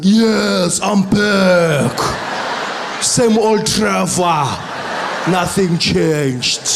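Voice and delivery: loud, drawn-out, exaggerated South African accent; over-prove you're local with a booming South African accent; overdone South African accent. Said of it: "I was losing my mind".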